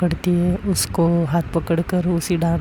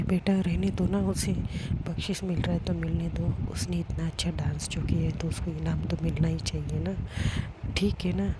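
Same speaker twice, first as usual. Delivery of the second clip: soft coaxing; 10 dB quieter than usual. Acted. Mother calmly persuades.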